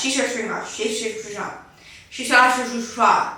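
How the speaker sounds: rushed, slurred repetitions; fast tongue twister. Shown as a demonstration. Host daring viewers to say it fast, tangled tongue twister. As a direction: race through it until the words tangle. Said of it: "say that five times fast".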